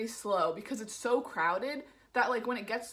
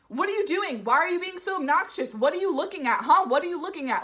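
high, loud rapid-fire demands; imitated belligerent challenge; 6 semitones higher, 7 dB louder than usual. Man harassing seated passengers, imitated high belligerence. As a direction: mimic someone picking a fight, loudly.

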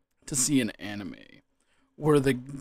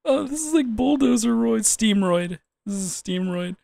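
high and loud, giggling; laughing; 9 semitones higher, 6 dB louder than usual. Real, not acted. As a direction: still laughing while naming. Giggling while naming cards.

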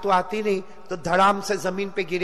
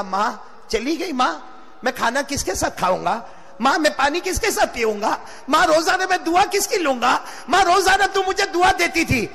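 plaintive wail climbing higher and higher; acted tearful lament; 7 semitones higher than usual. Companion grieving to his dead mother, acted tearful lament. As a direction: start subdued, build to a pleading cry. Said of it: "इतना रोते रोते कहा".

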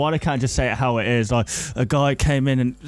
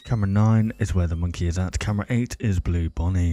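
lower-pitched documentary-style commentator; mock commentating; 5 semitones lower than usual. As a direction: narrate the game like a commentator. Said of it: "So I'll be doing the commentating".

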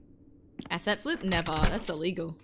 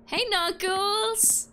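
drawn-out greeting; 10 semitones higher, 3 dB louder, much slower than usual. Real, not acted. Stretched greeting to chatter.

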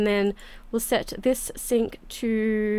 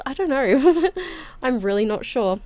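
usual speaking voice

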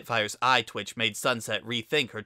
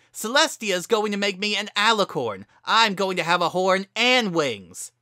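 fast, loud furious retort; angry shouting; 10 semitones higher, 8 dB louder than usual. A narrator's reading. Heated argument.

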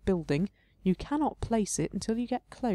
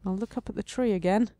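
ordinary delivery

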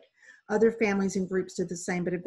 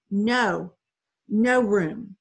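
firm refusal; 7 dB louder, much slower than usual. Acted. Boat refuses boy.

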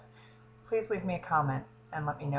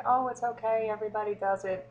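high, louder sing-song comforting; mock reassurance; 7 semitones higher, 4 dB louder than usual. Mocking the comforting viewers she doesn't want, in a high sing-song. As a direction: coo it like a pitying friend. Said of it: "people to come and say".

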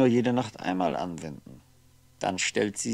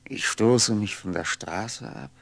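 normal talking voice

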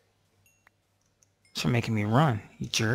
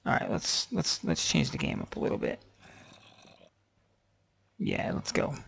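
whispering; 7 semitones lower, 5 dB quieter than usual. Real, not acted. Whispered aside.

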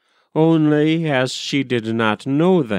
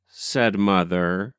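ordinary delivery

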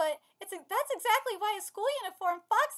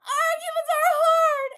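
high, loud, drawn-out complaint; hammed-up high lament; mock wail; 6 semitones higher, 8 dB louder, slower than usual. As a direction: wail it slowly in exaggerated distress. Mock lament about the argument.

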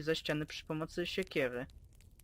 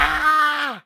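pained scream; 8 semitones higher, 14 dB louder than usual. Acted. Pup crashes into door.

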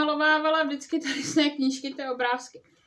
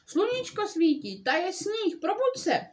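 eager coaxing; 7 semitones higher than usual. Acted. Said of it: "pokřikoval Kvak".

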